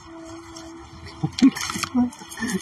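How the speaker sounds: breathy high laugh; giggling at herself; high giggle. Real, not acted. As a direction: burst into a squeaky laugh.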